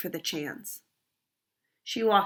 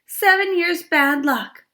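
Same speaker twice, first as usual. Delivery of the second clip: dismayed; 8 semitones higher, 6 dB louder than usual. Acted. Goldie dismayed after crash.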